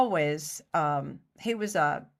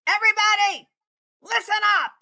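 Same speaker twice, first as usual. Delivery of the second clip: much higher, loud call to everyone; calling the crew; 8 dB louder, much faster than usual. Retold or imitated. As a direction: grab the whole stage's attention. Director calls whole crew.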